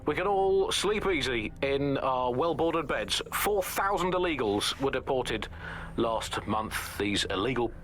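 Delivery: ordinary delivery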